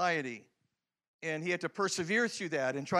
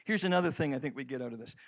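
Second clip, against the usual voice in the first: ordinary delivery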